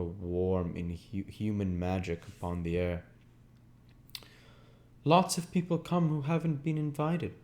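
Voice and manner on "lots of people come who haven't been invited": higher, lightly voiced Daisy blurting it out; a sudden remark from Daisy, a touch higher; Daisy's voice. A narrator's reading. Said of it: "she said, suddenly".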